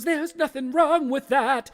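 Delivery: high, loud, drawn-out protest; indignant haunted man. Acted. Steve defends his weight loss to phantoms, high indignant protest. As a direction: belt it out in hammed-up indignation. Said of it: "And Steve goes".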